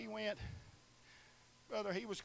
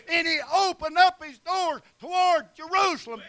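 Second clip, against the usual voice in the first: loud, high, shouted preaching; full-force preaching; 8 semitones higher, 18 dB louder than usual. Acted. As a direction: preach it out with fire to the congregation.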